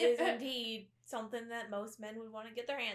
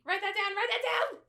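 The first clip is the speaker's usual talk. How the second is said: excited call; 9 semitones higher, 7 dB louder than usual. Real, not acted. Excited calling out.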